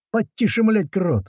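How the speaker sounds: high-pitched cursing yell; angry yell. A narrator's reading. Thrown passenger to driver Крот on a violent bump, yelling high. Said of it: "заорал кирпич".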